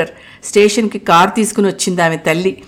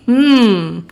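laughing; 3 semitones higher, much slower than usual. A narrator's reading. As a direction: chuckle at the question. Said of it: "అన్నాడు నవ్వుతూ".

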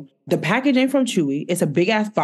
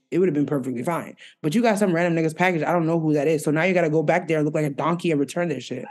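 fast rant; faster than usual. Real, not acted. Venting about the package mix-up.

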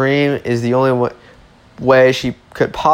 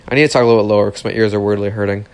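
ordinary delivery

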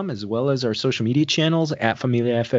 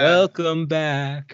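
stretched, lingering welcome back; slow drawn-out welcome; 4 semitones higher, much slower than usual. Acted. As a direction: drag out the greeting slowly.

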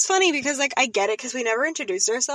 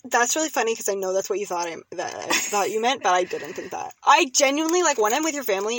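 ordinary talk

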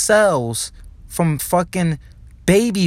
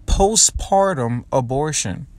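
ordinary delivery

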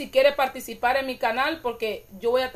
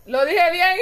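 laughing; 6 semitones higher, 3 dB louder, slower than usual. Real, not acted. Laughing at her own pronunciation.

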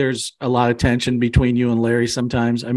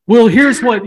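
raised, louder voice; 8 semitones higher, 8 dB louder, slower than usual. Real, not acted. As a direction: jump in loud to take the floor. Cutting in to explain.

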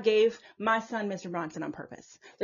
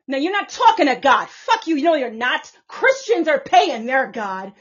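angry rant; 2 semitones higher, 8 dB louder than usual. Real, not acted.

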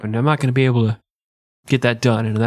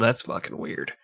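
muttered; 4 semitones lower, 10 dB quieter than usual. Real, not acted. Muttered aside.

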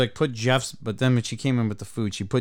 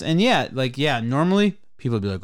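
ordinary talk, nothing marked

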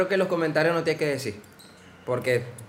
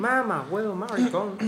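imitating a gringo's Venezuelan slang; mock gringo accent; 4 semitones higher, 4 dB quieter, slower than usual. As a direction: parody a foreigner saying Venezuelan words. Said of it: "el video del gringo que dice". (imitando a un gringo hablando venezolano)

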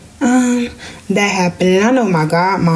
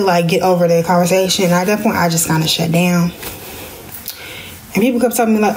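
ordinary delivery

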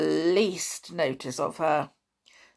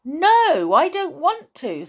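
high, loud, alarmed refusal; horrified girl; 11 semitones higher, 7 dB louder than usual. A narrator's reading. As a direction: protest in horror. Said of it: "said Anne in a horrified voice".

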